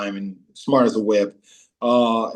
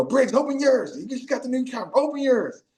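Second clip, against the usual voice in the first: high-pitched mock holler at a teammate; egging on; 12 semitones higher than usual. Players goading Briggs to open his check, high mock holler.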